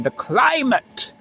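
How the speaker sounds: "climate" sung out high and slow; his signature way. Shown as a demonstration. Vlogger greeting the passing Pendolino, "climate" high and drawn out. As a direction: draw "climate" out at the top of the voice. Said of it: "that's how I say the climate".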